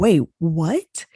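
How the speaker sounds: startled double take, rising on WHAT; baffled "hold on" reaction; retold disbelief. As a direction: stop short, then a puzzled rising question. Retells her shocked discovery.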